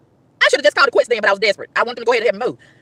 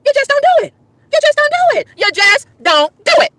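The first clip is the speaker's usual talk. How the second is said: high-pitched pleading insistence; exasperated; 12 semitones higher than usual. Real, not acted. Woman venting about bathroom manners, exasperated.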